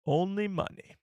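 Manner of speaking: lightly voiced alien junk dealer; Watto impression. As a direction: attempt at a Watto voice. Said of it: "that Watto impression".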